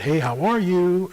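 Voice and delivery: slow greeting lifted high on "are"; stretched, swooping mimicked greeting; certain tone of voice. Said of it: "had a certain tone of voice".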